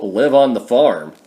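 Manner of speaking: usual speaking voice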